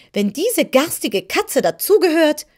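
high, loud sneer; contemptuous. Acted. The hen sneers at the kitten, high and scornful. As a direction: toss it off with scorn, pitched high. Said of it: "sagte Belina verächtlich".

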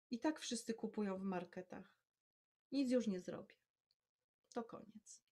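husband speaking quietly, fading to almost nothing; husband confessing the business is lost, quiet. A narrator's reading. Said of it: "cicho mówi".

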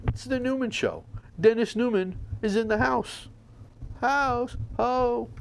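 high, soft show intro; show announcer. Acted.

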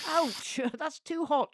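squealing. A narrator's reading. Beryl the bucket burnt by hot water, squealing. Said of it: "she squealed".

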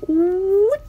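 high squeaky whoop; a high cartoon whoop for the alien; silly alien noise. Gamer voicing the little alien's hair. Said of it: "It goes".

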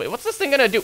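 ordinary talk

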